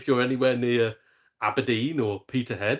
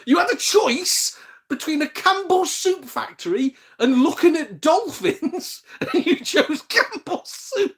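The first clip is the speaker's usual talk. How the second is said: much higher and louder, slowed, laughing disbelief at his mate's choice; mock incredulous rant; 6 dB louder, slower than usual. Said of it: "I was like".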